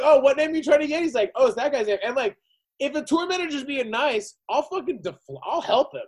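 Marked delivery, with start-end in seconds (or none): 0.0-2.0 mock innocent. Acting out the promoter exchange.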